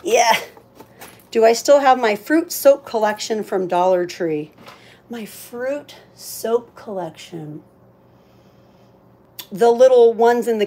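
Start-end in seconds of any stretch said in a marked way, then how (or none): none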